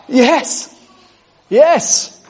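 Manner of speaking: high, thrilled cry of joy; excited yes. Real, not acted. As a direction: cheer it out, delighted by the promise. Preacher exults over scripture.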